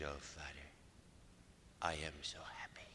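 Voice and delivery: soft, whispery imitation with a Mexican-American accent; hushed voice, slight Mexican-American accent. Woman telling her priest she is happy, slight Mexican-American accent, hushed. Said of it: "still has a slight accent".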